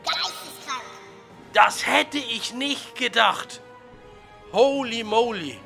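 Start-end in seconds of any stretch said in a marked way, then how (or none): none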